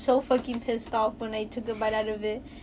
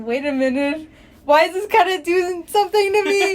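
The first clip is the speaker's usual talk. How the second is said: rising into a high, loud fluster; 6 semitones higher, 6 dB louder than usual. Retold or imitated. Retelling her movie reaction, rising flustered high. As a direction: caught off guard and swooning. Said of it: "and I was like".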